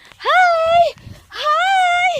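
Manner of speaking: very high, loud squeaky greeting. Real, not acted. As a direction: squeal a cute hello.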